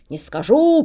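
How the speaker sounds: sharp loud refusal; the monk shouting down the cook. Acted. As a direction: snap it out loud. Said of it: "кричит на неё монах".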